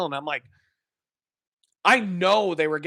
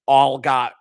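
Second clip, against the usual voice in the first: ordinary talk, nothing marked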